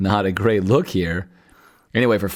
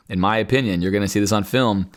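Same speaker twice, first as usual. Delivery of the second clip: ordinary talk, nothing marked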